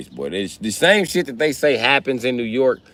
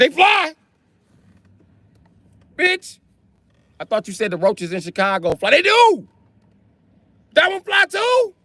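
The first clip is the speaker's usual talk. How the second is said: high incredulous yelling. Retold or imitated. Arguing with his girl about the flying roach, high incredulous shout. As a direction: voice way up, shouting in disbelief.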